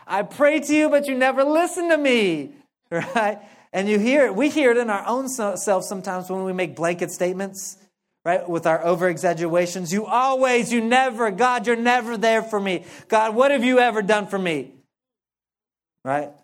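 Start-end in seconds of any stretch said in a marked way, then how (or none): 0.1-2.5 put-on high whiny spoiled-kid voice, louder; a pouting kid who never gets heard. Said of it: "a little bit of a spoiled kid in there". 9.9-14.7 higher, whiny blanket complaints; mock-whine the over-exaggerations; grumbling at God like a sulky kid; complaining voice. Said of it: "this kind of complaining voice".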